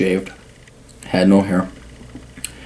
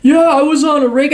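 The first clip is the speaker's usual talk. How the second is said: much higher and louder mock boast; mocking old hippie woman; 4 dB louder than usual. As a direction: squeak out her feeble claim. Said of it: "She's like".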